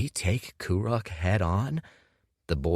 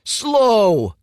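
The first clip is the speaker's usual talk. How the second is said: much higher and much louder furious yell; 11 dB louder than usual. A narrator's reading. Humiliated Kuruk yells at Dorian beside his father's grave. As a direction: scream the insult at him. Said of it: "yelled Kuruk".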